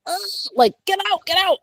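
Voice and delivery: very high, squeezed squeal of alarm; mock panic. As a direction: act out a frantic urge to escape. Acts out panic at seeing own future.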